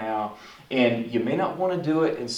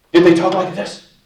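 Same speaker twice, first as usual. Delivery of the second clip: much louder, higher demonstration voice; raised, overdone voice; loud, harsh tone demo; 4 semitones higher, 10 dB louder than usual. Shown as a demonstration. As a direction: blurt it out forcefully to show a tone. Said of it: "what was their tone of voice".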